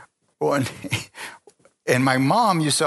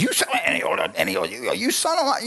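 a hint of a child cussing, pitch climbing; mock fighting voice, climbing in pitch; imitating boy's toy fight; 10 semitones higher, faster than usual. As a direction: play-act toys squabbling, rising high. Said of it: "they're fighting".

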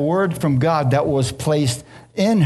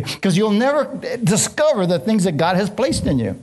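raised, driving preacher's cadence; preaching at full force; 6 semitones higher than usual. Acted. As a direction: preach it higher and harder, driving the point home.

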